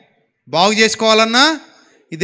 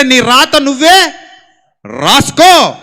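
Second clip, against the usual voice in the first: loud, slow proclamation of the saying; loud quote; 4 semitones higher, 7 dB louder, much slower than usual. Retold or imitated. Preacher loudly repeats the Lord's saying to the youth. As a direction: hammer the verse out slowly and loudly. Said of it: "అన్నాడు ఆయన".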